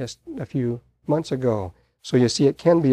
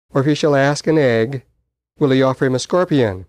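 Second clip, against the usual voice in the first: scripture reading; 4 dB louder than usual. Acted. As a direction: proclaim each verse to the congregation. Reads scripture aloud.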